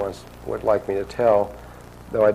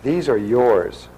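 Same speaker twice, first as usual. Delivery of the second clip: slow; 4 dB louder, much slower than usual. Retold or imitated. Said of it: "she says".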